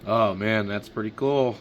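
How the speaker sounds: ordinary delivery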